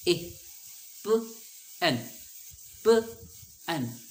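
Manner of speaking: teacher pulls "प" and "एन" apart; sounding out short vowels. Shown as a demonstration. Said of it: "क्या इसका sound बन रहा था". ("प" और "एन" अलग करके ऊँचा बोलें)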